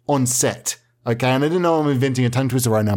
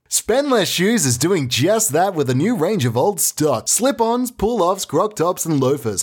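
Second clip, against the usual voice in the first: sing-song retail commercial voice, climbing higher; hard-sell ad read; 6 semitones higher than usual. Acted. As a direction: pitch the bargains with mounting excitement. Reads a shoe-shop advert.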